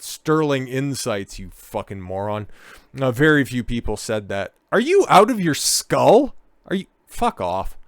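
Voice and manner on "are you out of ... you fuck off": outraged yell. Real, not acted. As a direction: yell it at the screen in total disbelief. Furious disbelief at interview.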